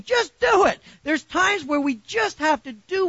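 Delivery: high, loud preacher's cry; rally the church at full voice; preaching shout. Real, not acted. Preacher repeats the slogan.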